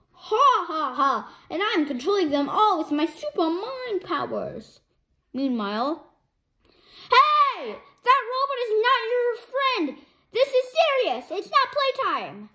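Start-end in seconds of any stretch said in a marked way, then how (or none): 0.1-1.4 evil laugh. Acted. Villain laughs. 7.1-12.5 urgent shout. Acted. Urgent warning.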